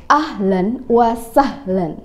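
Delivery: slow, careful teacher demo of Ahlan wa sahlan; slow model phrase. Shown as a demonstration. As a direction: draw out Ahlan wa sahlan slowly for pupils to repeat. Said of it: "Yuk, ikutin".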